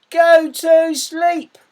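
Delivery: high, loud, very slow exasperated yell; intense command. Shown as a demonstration. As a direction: bellow it at a child who won't settle. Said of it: "show a bit of intense and intense emotion".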